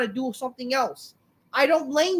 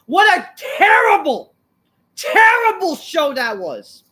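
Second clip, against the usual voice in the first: angry shout; 6 semitones higher, 7 dB louder, slower than usual. Real, not acted. Ranting about a bad show.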